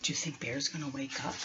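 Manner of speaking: barely audible, fast hush; whispered suspense. Pretend. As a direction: whisper the cliffhanger. Suspenseful aside.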